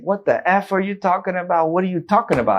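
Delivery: high, heated yelling back; angry swearing. Retold or imitated. Patient yells at the doctor over the diagnosis, angry. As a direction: snap at him in disbelief. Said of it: "I started swearing at him".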